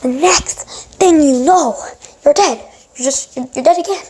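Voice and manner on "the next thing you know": "the next thing you know" stretched out slowly; slow, drawn-out repeat. Real, not acted. Gamer retelling his sudden death, slow and drawn-out.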